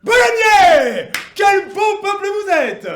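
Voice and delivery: huge, high, drawn-out hail; slow, soaring proclamation at full voice; rousing oratorical shout. Acted. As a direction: bellow grand praise to a whole crowd. Orator hails the crowd.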